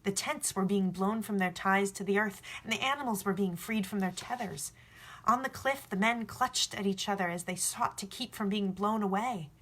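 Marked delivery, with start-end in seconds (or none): none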